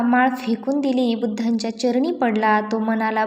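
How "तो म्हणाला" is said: plain reading voice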